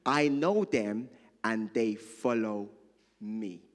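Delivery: measured, deliberate Bible reading; scripture reading. Acted. Pastor reading John ten to the congregation, measured. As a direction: read the passage with weight.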